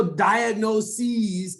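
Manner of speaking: "diagnoses" slowly, last syllable stretched to "-seez"; long -seez ending. Shown as a demonstration. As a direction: draw out the end of "diagnoses" as SEEZ. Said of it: "it's just like your name".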